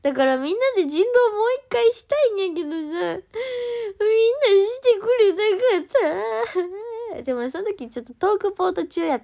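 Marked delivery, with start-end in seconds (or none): 0.0-7.2 whiny complaint. Real, not acted. Complaining to fans that nobody would replay werewolf, high whiny sulk. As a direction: sulk that nobody would play, stretching the end.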